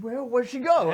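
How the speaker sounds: high, loud baffled cry; confused villain. Acted. The attacker losing sight of her target, confused. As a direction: search around, puzzled. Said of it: "she's like".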